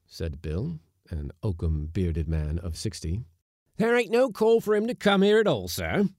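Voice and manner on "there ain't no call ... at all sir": high, loud seaman's protest; shouting. A narrator's reading. Seaman from the galley to the skipper, shouting. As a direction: yell it up to the captain. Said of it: "shouted another seaman".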